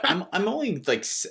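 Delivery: ordinary talk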